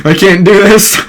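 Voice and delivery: high, loud, hammed-up despair; mock panic. Madara facing a powered-up Naruto, mock panic. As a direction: give up in a high, panicky cry.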